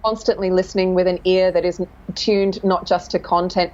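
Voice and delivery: normal talking voice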